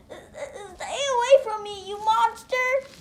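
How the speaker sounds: high, slow, frightened cry; terrified boy recoiling from a monster; scared crying out. Acted. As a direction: shriek it at the monster in fear. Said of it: "as he cries".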